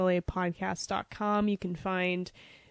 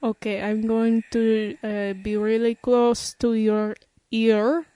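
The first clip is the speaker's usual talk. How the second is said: put-on French accent. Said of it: "French accent I could do".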